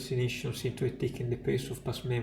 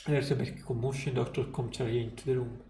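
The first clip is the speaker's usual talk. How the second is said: ordinary delivery, nothing marked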